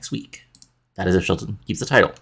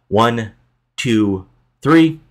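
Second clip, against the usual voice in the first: referee count; 3 semitones higher, 4 dB louder, much slower than usual. Acted. Calling the pinfall count.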